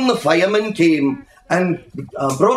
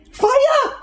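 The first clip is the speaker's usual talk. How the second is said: cried out. A narrator's reading. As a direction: shriek the alarm at the smoke. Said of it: "cried Missus Prothero".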